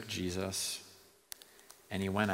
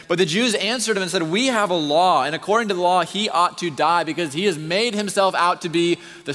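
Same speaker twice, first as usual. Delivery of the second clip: loud, much higher, driving sermon voice; preaching at full force; 10 semitones higher, 13 dB louder than usual. Acted. Preacher thundering the trial scene at the congregation, loud and high. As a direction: pound it out to the back row.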